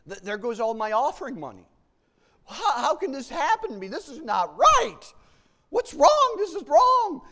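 high, loud distressed wail; mock dismay. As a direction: wail over the lost money, hammed-up. Acted dismay over lost money.

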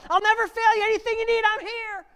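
high, loud declaring voice; God's promise. Pretend. God promising the fearful believer, high loud proclamation.

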